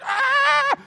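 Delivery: a sudden, very high, loud shriek; wife on the phone, loud high scream; retold scream. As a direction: let out a panicked scream over the phone. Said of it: "she's on the other end, like, screaming".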